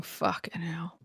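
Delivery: retold curse under the breath; low, hushed mock dismay; whispered disbelief. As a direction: whisper it as if shaking your head. Retold head-shaking disbelief.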